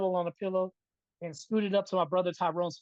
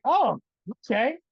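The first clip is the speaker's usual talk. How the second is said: high, louder oh okay; startled relief, pitched up; retold relieved surprise; 5 semitones higher, 6 dB louder than usual. Relieved boy allowed to pee.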